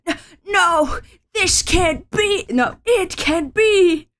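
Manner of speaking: distraught denial. Acted. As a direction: gasp out the denial, voice lifted and slowed.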